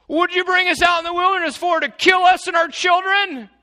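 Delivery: high, loud shouted accusation; mock accusation. Israelites shouting at Moses, mocked, high and loud. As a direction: hurl it like an outraged mob. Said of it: "the next statement out of their mouth".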